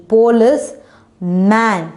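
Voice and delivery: "police, man" with a clear break between parts; split into syllables. Shown as a demonstration. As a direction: say "police", pause, then "man". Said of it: "If you divide it into two".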